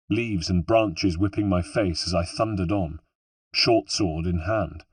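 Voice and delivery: ordinary delivery, nothing marked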